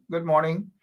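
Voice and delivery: usual speaking voice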